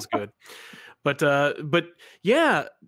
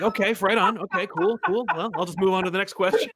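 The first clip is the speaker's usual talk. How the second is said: awkward brush-off; 6 semitones higher, 3 dB louder than usual. Retold or imitated. Retelling his awkward retreat from guest.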